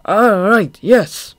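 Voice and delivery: high, loud, cowed whimper; a frightened whimper of surrender. A narrator's reading. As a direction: give in with a whine. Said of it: "whimpered Throb".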